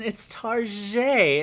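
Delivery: "Target" as a drawn-out tar-ZHAY; mock-French correction. Friends correct him on the store name, mock-French pronunciation. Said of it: "they're like".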